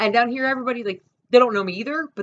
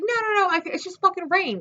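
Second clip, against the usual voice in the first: insistent refusal; 10 semitones higher than usual. Retold or imitated. Acting out refusing a ride.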